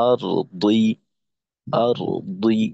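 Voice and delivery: দুই said heavy and thick after আর; heavy thick zer. Shown as a demonstration. Teacher giving an example of the thick zer, heavy emphatic pronunciation. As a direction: lean into a full emphatic ḍad on দুই. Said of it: "জেরের উচ্চারণটা আরেকটু মোটা".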